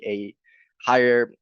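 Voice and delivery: usual speaking voice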